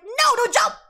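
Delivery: alarmed plea. Acted. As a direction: call out to stop a jumper. Pleading with girl on roof.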